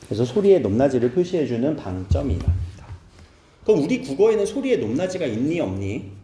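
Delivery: normal talking voice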